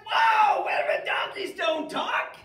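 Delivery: very loud, high shocked shout; freaking out. Retold or imitated. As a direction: yell in disbelief at a talking donkey. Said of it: "I'd be freaking out".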